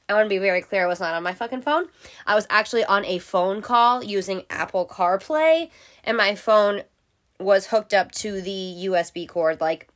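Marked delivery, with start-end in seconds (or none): none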